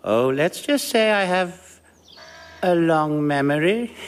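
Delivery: slow, knowing, sly; sinister hint. Acted. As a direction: let the hint hang slowly. Hinting sinisterly at his identity.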